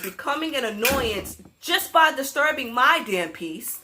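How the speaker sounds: usual speaking voice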